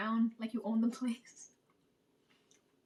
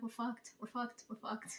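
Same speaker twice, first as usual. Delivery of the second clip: resigned doom; 9 dB quieter than usual. Retold or imitated. Resigned doom at world's end.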